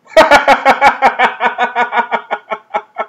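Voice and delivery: high, loud cackling laugh; helpless giggling fit; loud burst of laughter. Real, not acted. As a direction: crack up at the absurdity. Cracking up at plot.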